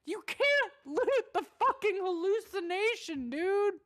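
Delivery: high, loud disbelieving shout; exasperated yell. Retold or imitated. Gamer yelling at her partner for looting. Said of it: "And I'm like".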